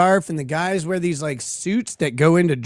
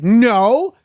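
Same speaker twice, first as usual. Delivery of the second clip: loud, flustered denial that winds down into a doubtful question; mock-outraged protest fading to a whiny "right?"; mocking Destiny; 6 semitones higher, 4 dB louder, slower than usual. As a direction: play the clueless guy who won't believe it, loudest on the first noes. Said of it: "He's like".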